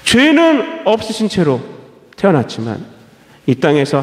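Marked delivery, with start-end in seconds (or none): none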